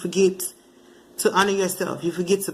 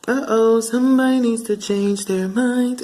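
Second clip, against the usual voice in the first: teasing; 4 semitones higher, slower than usual. Real, not acted. Teasing warning.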